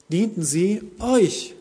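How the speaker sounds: "euch" set apart and emphasized; stress on euch. Shown as a demonstration. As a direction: spell it out slowly, driving home "euch". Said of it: "Petrus betont".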